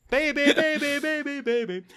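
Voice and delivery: very high, comic repeated cry; hammed-up high voice, rattled off as a bit; mock babbling. Riffing in an impression, high voice.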